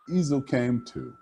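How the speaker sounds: ordinary delivery, nothing marked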